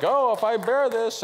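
high, mocking religious whine; mocking pious whine. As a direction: sneer at the martyr talk with a put-on pious voice. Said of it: "this stupid religion that's like".

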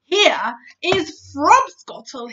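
loud, slow proud giant; proud booming giant; proud giant cry. Acted. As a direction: present it grandly, loud. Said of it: "he cried".

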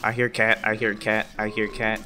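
loud repeated warning, fading a little; loud alarm. Real, not acted. Player warns his friends the cat is near, loud alarm. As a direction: call out the danger.

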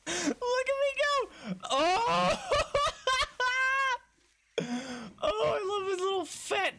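very high squeal breaking into laughter. Real, not acted. Player gushing over the running wombat, squealing laughter. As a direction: gush through helpless giggles, way up high.

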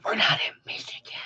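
hushed, whispered retold protest; alarmed whisper. Traveler warning the gummy giver, whispered. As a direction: whisper it fast, as if it's a secret.

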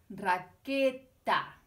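teacher repeats raqueta to show the ending, slow and loud; slow, aloud. Shown as a demonstration. Raqueta stretched, ending -eta stressed. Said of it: "la consonante eta".

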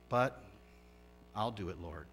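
grudgingly agree, with a sigh before "but"; quiet, resigned fisherman giving in; reluctant obedience. Acted. Peter reluctantly obeys Jesus in story.

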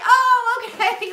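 high, startled "oh, okay"; a hint of a startled reply to a fan; caught off guard. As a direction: react taken aback, awkward. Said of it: "I was like".

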